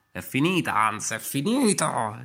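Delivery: più alto e forte, lento; zia che annuncia. Acted. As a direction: sentenza strascicata. Voce della zia sentita. (zia che dichiara la fine, voce alta e lenta)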